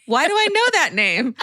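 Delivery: acted puzzlement, voice lifting high; puzzled inner thought. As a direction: wonder aloud where you know it from. Acts out inner puzzlement at name.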